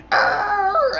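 loud, high sustained growling yell; imitated roar. Driver charging at his enemy, loud mock roar. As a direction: let out a long high "arr".

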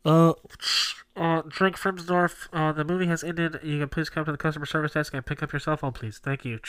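page him like a theater PA; flat echoey PA page. Pretend. Mock theater PA announcement.

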